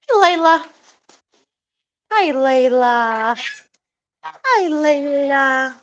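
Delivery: high, slow, drawn-out coo; cooing pet voice. Real, not acted. Greeting her hen Layla, high slow cooing.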